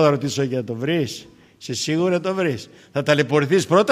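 usual speaking voice

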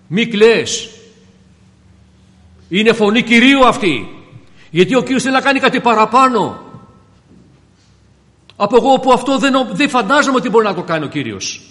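full-force sermon cry; impassioned preaching. Real, not acted. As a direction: ring it out to the congregation.

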